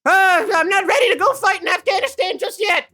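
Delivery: put-on high, loud old woman jolting awake; a startled grandma blurting out; old lady shout. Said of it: "she says".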